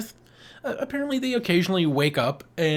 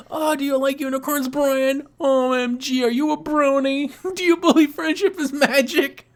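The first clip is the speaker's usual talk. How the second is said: mocking tease; 9 semitones higher than usual. Real, not acted. Teasing Brian about unicorns.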